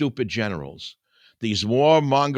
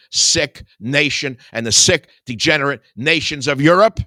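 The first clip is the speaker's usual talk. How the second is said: louder, higher, fast denunciation; fiery louder preaching; preaching shout; 4 semitones higher, 8 dB louder than usual. Real, not acted. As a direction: thunder it at the nation. Sermon denunciation rising to a shout.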